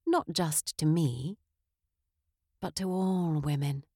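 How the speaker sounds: ordinary talk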